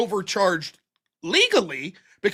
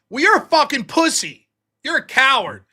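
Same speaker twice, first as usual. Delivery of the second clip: mock insult; 5 semitones higher, 5 dB louder than usual. Example of escalating insults.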